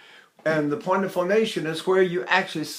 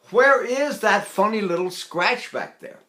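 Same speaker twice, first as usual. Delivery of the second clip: normal talking voice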